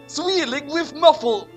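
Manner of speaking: normal talking voice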